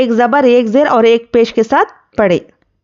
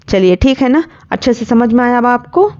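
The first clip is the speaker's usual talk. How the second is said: normal talking voice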